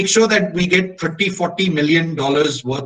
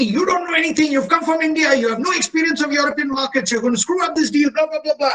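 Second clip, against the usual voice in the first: much higher mock tirade; scolding attack; 9 semitones higher than usual. German and American colleagues berate the newcomer, high mock scolding. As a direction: imitate colleagues ganging up, mildly hammed. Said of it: "They went at me".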